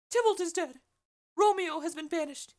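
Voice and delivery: put-on high-pitched old nurse voice, loud and pressing; the Nurse blurting bad news, high and loud. Nurse's lines in read play.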